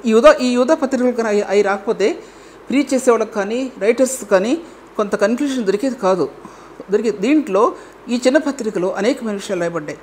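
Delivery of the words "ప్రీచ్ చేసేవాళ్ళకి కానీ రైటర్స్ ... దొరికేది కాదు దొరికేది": usual speaking voice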